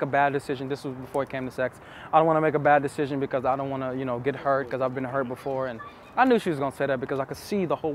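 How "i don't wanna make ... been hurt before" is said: fast run-through of her worry; hurried mock quote of her fear; quicker retelling. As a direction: rattle it off quickly. Repeats her words, faster.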